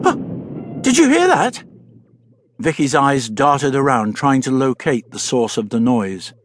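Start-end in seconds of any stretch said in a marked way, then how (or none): 0.0-1.6 startled. Acted. Sudden loud bang startles Vicky.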